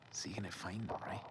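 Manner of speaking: hushed whisper to himself. Real, not acted. Whispering to viewers while hunting peaches.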